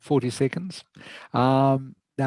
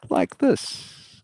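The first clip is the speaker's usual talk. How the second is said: 1 sing-song pitch demo; 11 semitones higher, 3 dB louder than usual. Shown as a demonstration. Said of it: "you can hear how I'm going up and down".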